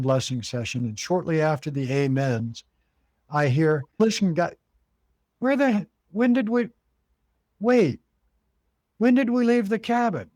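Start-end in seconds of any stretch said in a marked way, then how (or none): 4.0-10.3 high-pitched, halting confused questioning; retold bewilderment. New guy interrupting after the prayer, imitated in high-pitched confusion.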